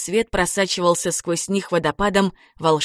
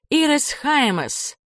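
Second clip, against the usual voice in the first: mild calling out; 8 semitones higher, slower than usual. A narrator's reading. Waitresses call after departing guests, high slow mock call. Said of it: "хором кричали нам вслед".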